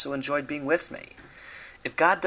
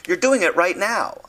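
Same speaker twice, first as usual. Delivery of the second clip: snappy, pointed comeback, louder and higher; sharp retort; 7 semitones higher, 7 dB louder than usual. Retold or imitated. Wife catching her husband rephrasing again, sharp retort. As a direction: bite back at him, exasperated. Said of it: "She retorted".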